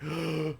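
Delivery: mock gasp of astonishment; shocked gasp. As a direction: suck in air, imitating sudden shock. Acts out shock at reveal.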